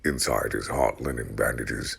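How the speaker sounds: ordinary delivery, nothing marked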